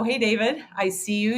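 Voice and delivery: usual speaking voice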